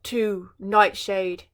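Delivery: slow, faltering scared king; the king, frightened, stammering to Nightshade. Acted. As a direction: stumble over the first words. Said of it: "the king stammered".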